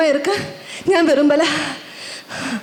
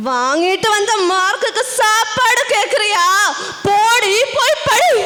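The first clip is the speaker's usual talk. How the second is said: scolding parent, building to a shout; scold, then draw out the shouted dismissal; acted parental outburst, rising in pitch; angry parent; 3 semitones higher than usual. Angry parent refuses child food.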